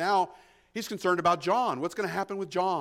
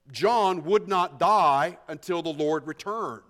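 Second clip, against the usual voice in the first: slow emphatic preaching; 3 dB louder, slower than usual. Real, not acted. Preacher slows to stress rumor.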